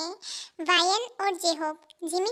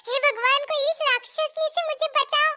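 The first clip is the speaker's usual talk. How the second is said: high, comic wail for help; hammed-up plea; 7 semitones higher than usual. Friend begs rescue from the demoness, hammed-up plea. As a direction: plead to heaven in mock terror. Begs God to save him.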